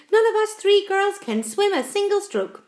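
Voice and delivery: high, worried spider voice; anxious protest. A narrator's reading. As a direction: lament it in alarm. Miss Spider joins the panic.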